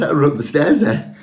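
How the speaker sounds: normal talking voice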